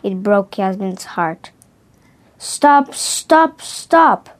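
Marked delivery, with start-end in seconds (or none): 2.4-4.3 raised, higher-pitched cries of stop; crying out. A narrator's reading. Upset artist begging it to stop, crying out. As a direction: plead loudly, each stop rising in force. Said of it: "she cried, her eyes full of tears".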